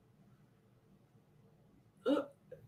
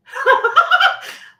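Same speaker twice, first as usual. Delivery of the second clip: high burst of laughter; 13 semitones higher, 10 dB louder than usual. Real, not acted. Giggle fit.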